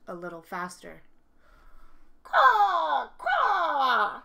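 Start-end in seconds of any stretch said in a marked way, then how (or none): 2.2-4.2 loud, high crow screech falling away; screaming crow caw. Acted. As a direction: squawk like a crow, long and harsh.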